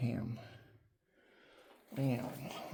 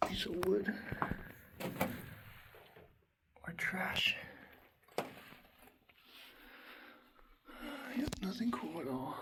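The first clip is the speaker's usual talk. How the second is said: whispering; 5 semitones higher, 7 dB quieter than usual. Real, not acted. Murmured finds inside the cave.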